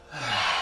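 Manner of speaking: deep low audible sigh; dramatic sigh. Shown as a demonstration. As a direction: let out a big heavy sigh. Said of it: "and a nice big sigh".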